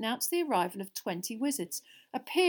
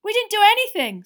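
high, loud protest; indignant; 12 semitones higher, 14 dB louder than usual. A narrator's reading. Ron rubbing his elbow, indignant protest. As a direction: deny it hotly, affronted. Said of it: "looking indignantly at his father".

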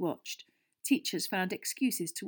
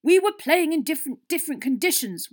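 high, loud heated objection; shouting; 8 semitones higher, 11 dB louder than usual. A narrator's reading. Captain arguing back at Fred, shouting. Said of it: "Wood shouted".

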